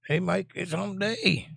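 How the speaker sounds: camel ad quote. Retold or imitated. Quoting the hump day camel.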